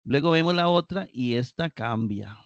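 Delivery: ordinary delivery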